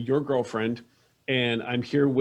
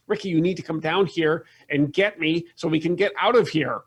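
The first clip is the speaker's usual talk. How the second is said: higher-pitched, rambling rant; complaining mother; 4 semitones higher than usual. Acted. Mother complains to Ricky about being stuck, ranting. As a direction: run on indignantly, pitch climbing.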